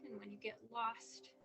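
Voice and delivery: measured visualization voice with long pauses; unhurried guiding, letting each image settle; guided meditation. Real, not acted. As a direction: lead them calmly into an imagined safe place.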